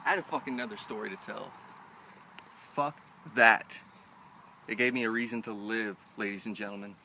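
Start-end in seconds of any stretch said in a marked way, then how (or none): 2.7-3.8 sudden loud outburst; angry yell at the reader; shouted. Real, not acted. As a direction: bark it out hard.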